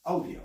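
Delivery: "audio" starting with a closed OH; attempted "audio". Shown as a demonstration. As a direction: try "audio" as OH-dee-oh. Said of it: "Now I'm gonna say it".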